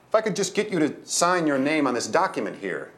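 pleasant but assertive. A narrator's reading. Doorman asking for a signature after an altercation, pleasant but assertive. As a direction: press the request pleasantly. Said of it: "he said in a pleasant but assertive voice".

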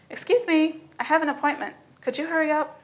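a hint of a bossy princess in a hurry; impatient girl.

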